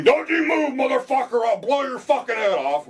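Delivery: high, loud mock cop shout; retold police yell. Raiding cop yelling at the suspect, high and loud. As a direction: bark it like a raiding officer. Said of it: "you yell and scream".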